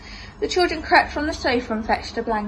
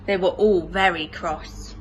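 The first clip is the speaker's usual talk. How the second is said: usual speaking voice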